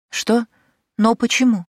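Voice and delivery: high, loud shocked outcry; can't believe she refuses; startled protest. Acted.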